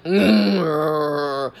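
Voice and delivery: deep noncommittal grunt; the ferryman's wordless low hum; low Charon grunt. Retold or imitated. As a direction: grunt low without opening up. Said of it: "Charon is like".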